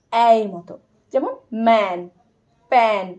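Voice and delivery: ম্যান and প্যান with a vowel between e and a; modelling the sound between e and a; ae vowel demo. Shown as a demonstration. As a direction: open the mouth for ম্যান, প্যান. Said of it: "ই আর আ এর মাঝামাঝি একটা form".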